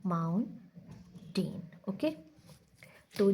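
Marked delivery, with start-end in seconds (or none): 0.1-1.6 माउंटेन said very slowly and softly, syllable by syllable; slow syllable demo; syllable breakdown. Shown as a demonstration. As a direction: split माउंटेन into its parts. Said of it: "जितने parts बनेंगे उतने syllables होंगे".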